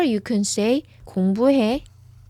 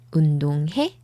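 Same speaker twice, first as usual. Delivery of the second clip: 운동해 low, then swept up on 해; questioning lift on the end of 운동해; rising question intonation; 4 semitones lower, much faster than usual. Shown as a demonstration. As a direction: ask 운동해 with a clear final rise. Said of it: "운동해 goes up".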